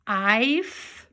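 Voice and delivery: I then F, as "eye... fff"; sounding out long I. Shown as a demonstration. Teacher trying the other vowel, separate "eye" and "fff" sounds. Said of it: "do it with a long I sound".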